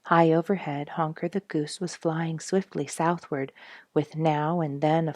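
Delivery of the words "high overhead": ordinary delivery, nothing marked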